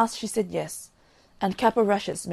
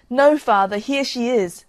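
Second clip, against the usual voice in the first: joyful reveal; 4 semitones higher, 6 dB louder than usual. Acted. Daughter reveals herself to father.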